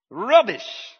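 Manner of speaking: high, loud, hammed-up British accent. Shown as a demonstration. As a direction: belt it out in a playful British accent. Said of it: "Everybody in your best British accent".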